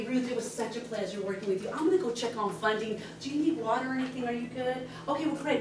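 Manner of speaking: gentle, hushed role-play with the client; a realtor softly wrapping up with her buyer; soft client talk. Shown as a demonstration. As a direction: speak quietly as if beside the buyer at closing. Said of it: "I'd say".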